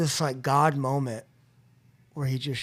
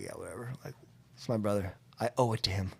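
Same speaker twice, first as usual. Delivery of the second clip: muttered much softer and lower, brushing it off; dismissive shrug; 6 semitones lower, 9 dB quieter, faster than usual. Retold or imitated. To himself, shrugging off his sponsor's advice, dismissive. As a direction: wave it away under your breath.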